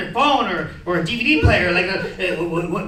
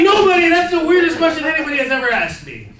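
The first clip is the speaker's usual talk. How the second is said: incredulous protest; 6 semitones higher than usual. Retold or imitated. [name] protesting to his stepdad, incredulous.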